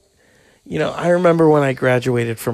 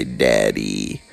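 much lower, drawn-out put-on voice; mock baby dinosaur. The dinosaur baby finally saying daddy, much lower put-on voice. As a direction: drop it way down like a stubborn dinosaur baby. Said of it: "trying to get me to say".

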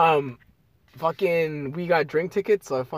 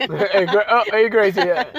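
imitating; 3 semitones higher, 7 dB louder than usual. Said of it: "They always say".

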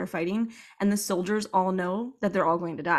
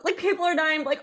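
ordinary talk

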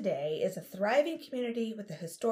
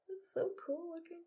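whispering; 9 semitones higher, 10 dB quieter than usual. Real, not acted. Admiring the spooky shot.

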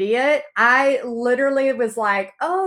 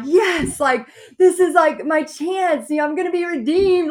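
high, eager burst of hope; excited; 6 semitones higher, 4 dB louder than usual. Retold or imitated. Telling the listener her hopes, excited. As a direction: sell the hope of being redeemed.

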